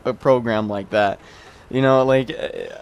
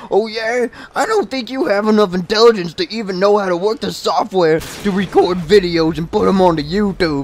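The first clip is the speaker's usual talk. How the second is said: high, scornful mocking kid voice; imitated teenage kid; 7 semitones higher, 4 dB louder than usual. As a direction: mimic a know-it-all young gamer. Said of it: "the local thirteen kid".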